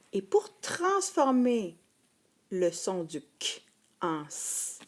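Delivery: usual speaking voice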